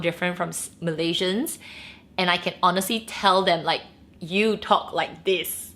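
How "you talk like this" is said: usual speaking voice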